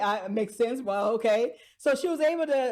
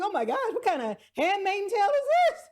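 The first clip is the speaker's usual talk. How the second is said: incredulous gasp; 7 semitones higher, faster than usual. Retold or imitated. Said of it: "I'm like".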